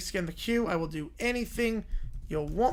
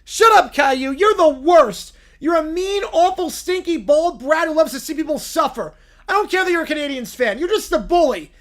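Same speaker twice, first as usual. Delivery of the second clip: higher, louder tantrum wail; a sulking kid about to lose it; upset whiny bear; 7 semitones higher, 11 dB louder than usual. Acted. Bear yells back.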